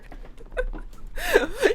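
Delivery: real burst of laughter; high laugh. Real, not acted. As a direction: crack up at the absurdity. Laughing at plot hole.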